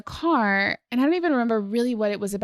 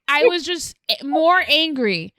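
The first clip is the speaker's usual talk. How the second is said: ordinary delivery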